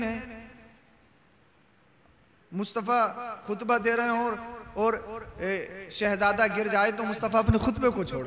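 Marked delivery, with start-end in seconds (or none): none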